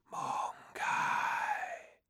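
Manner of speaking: whispering wind voice; ice spirit. Acted. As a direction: breathe it like the wind. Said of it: "whispered the wind".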